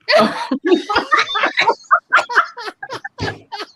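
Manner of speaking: long, high laughing fit; helpless laughter. Real, not acted. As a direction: burst out laughing loud and high, then run out. Helpless laughter at the absurd text.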